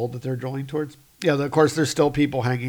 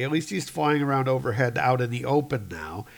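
plain reading voice

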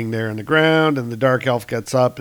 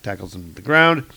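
ordinary delivery

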